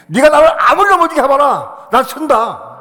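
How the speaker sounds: high, loud defiance; defiant cry. Retold or imitated. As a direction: throw it at the devil. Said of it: "외쳤느냐 하면".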